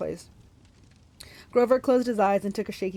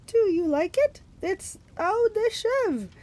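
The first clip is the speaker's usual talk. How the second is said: coy, very high falsetto; high-pitched put-on bridal simper; Grover; 13 semitones higher, slower than usual. A narrator's reading. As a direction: flirt like a fake bride. Grover flirts in falsetto disguise.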